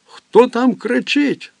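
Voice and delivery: put-on high, slow, small child's question; frightened child. Little boy asking about the voice, frightened. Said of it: "Хлопчик хотів спитати".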